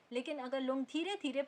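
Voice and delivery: ordinary talk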